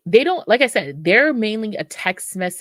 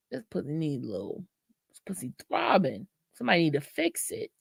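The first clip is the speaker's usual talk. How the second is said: sultry voice; 7 dB quieter, faster than usual. Pretend. Voicing her own horny thoughts.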